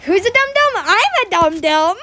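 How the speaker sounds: put-on goofy sing-song baby talk; playful pet talk. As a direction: gush at a dog, starting high. Voices dog talk after calling it Dum Dum.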